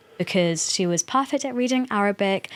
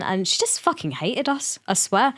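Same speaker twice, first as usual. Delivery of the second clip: usual speaking voice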